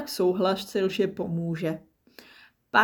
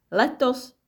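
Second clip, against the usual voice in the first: proud, lifted voice, a touch higher and louder; proudly; 6 semitones higher, 4 dB louder than usual. A narrator's reading. Leaf telling Nimble about her birthday, proudly. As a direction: announce it with pride. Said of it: "řekla hrdě".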